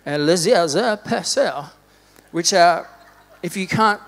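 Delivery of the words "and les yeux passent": slow, put-on French accent. Shown as a demonstration. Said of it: "I'm gonna say it in my French accent".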